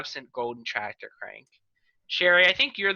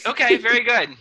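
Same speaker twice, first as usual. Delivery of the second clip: sarcastic praise; 7 semitones higher, 7 dB louder than usual. Acted. Mock approval of his freestyle.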